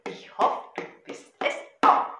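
loud rhythmic poem recital over a drum; Gedichtvortrag. Shown as a demonstration. (laut und rhythmisch zur Trommel vorgetragen)

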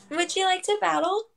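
usual speaking voice

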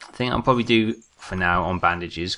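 usual speaking voice

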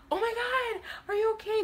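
high, startled concern; alarmed bystanders. Retold or imitated. Diners gasping at the spill, high and alarmed. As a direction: cry out in shock at the soaked boy.

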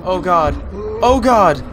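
high, loud panicked yelps; panic, it's chasing you; scared horror game streamer. Real, not acted. Fleeing a monster in game.

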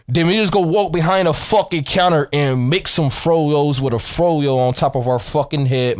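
ordinary talk, nothing marked

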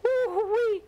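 high, thrilled woo-wee; excited squeal. Real, not acted. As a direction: squeal it out with a rush of nerves. Squeal on a risky climb.

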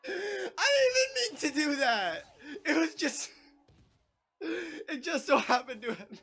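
very high, squealing through laughter; helpless laughter, almost crying; laughing hard. Real, not acted. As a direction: barely get it out for laughing. Accidental win, laughing too hard.

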